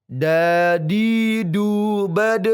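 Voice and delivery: "Dadidu bad" stretched slowly, high and loud, too heavy; wrong heavy dal. Shown as a demonstration. Teacher shows the wrong heavy dal, high and loud. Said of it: "jangan dibaca seperti ini".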